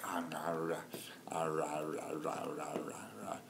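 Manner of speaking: soft playful chewing sounds; whispered chewing growls. Retold or imitated. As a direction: whisper little chomping growls like chewing jerky. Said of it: "They'll be like".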